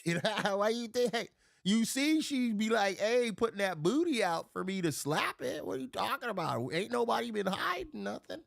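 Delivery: high, animated, exasperated comeback; indignant protest. Retold or imitated. Man telling friends the flirting was obvious, indignant. Acting out his indignant reply.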